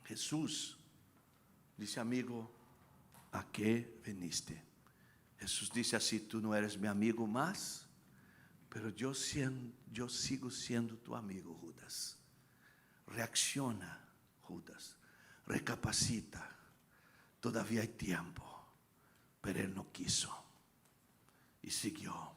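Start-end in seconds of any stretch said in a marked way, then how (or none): 2.0-4.6 soft, low, slow question to a friend; hushed, lightly acted Jesus; gentle Jesus. Acts out Jesus addressing Judas at betrayal. 6.2-18.4 hushed, tender appeal to a betrayer; soft acted entreaty, pauses between phrases; quiet plea of Jesus. Acts out Jesus pleading softly with Judas.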